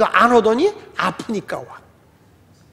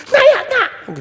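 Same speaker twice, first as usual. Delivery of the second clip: put-on childish insistence; much slower than usual.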